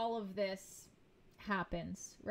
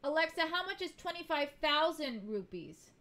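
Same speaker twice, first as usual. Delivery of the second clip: raised, higher voice to a device; calling to Alexa; 6 semitones higher, 5 dB louder than usual. Real, not acted. Vlogger asking Alexa for a conversion, calling out. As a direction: call out the question louder and higher.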